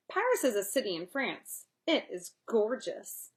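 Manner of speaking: usual speaking voice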